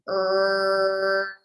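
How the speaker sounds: "er" held for several seconds on one note; long-held rhotic er. Shown as a demonstration. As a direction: sustain a strong American R in "er". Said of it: "hold the sound for a long time".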